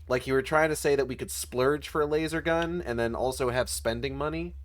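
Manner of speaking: ordinary talk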